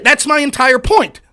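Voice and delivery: ordinary talk, nothing marked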